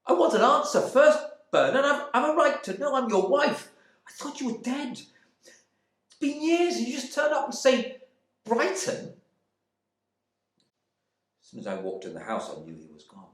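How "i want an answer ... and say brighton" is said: high, loud, furious demand; angry wife. Acted. Wife shouting at husband back from the dead, furious. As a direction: rage at him for the lost years. Wife's outraged outburst.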